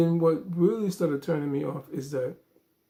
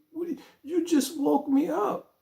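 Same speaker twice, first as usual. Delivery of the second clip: woken up, exasperated; 11 semitones higher than usual. Retold or imitated. Woken by a 4 a.m. call.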